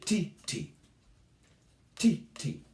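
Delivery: "tee" said short and crisp; rhythm syllables. Shown as a demonstration. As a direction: tap out each "tee" short. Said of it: "instead we say".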